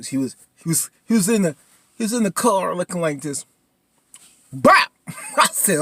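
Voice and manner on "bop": sudden loud, high gunshot noise; imitated gunshot. Recapping a TV shooting, imitated gunshot. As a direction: blurt out a loud pop like a gun.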